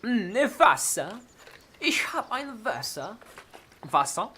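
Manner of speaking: careful German words. Shown as a demonstration. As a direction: say it like a German speaker. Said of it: "Hmm, water".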